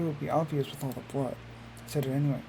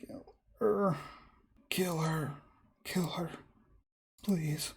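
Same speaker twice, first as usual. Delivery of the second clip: whistling, hissed begging; hissed plea; 3 semitones higher, much slower than usual. A narrator's reading. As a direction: hiss it out as an urgent plea. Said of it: "the words she's hissing out".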